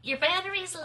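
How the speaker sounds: mimicking phone notification alert. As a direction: read it like a chirpy alert. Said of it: "the notification says".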